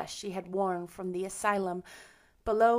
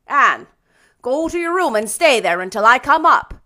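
loud, higher command; stern order; 4 semitones higher, 15 dB louder than usual. A narrator's reading. Marilla sends Anne to her room, stern. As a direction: send a child off firmly and loudly. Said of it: "recovering her powers of speech with difficulty".

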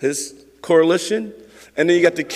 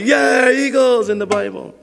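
loud, higher cheer of a football fan; mock crowd shout; 7 semitones higher, 3 dB louder than usual. Preacher mimicking the congregation's cheer for the Eagles. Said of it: "y'all probably say".

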